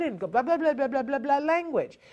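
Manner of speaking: mock babble. Shown as a demonstration. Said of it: "mocking the word flood".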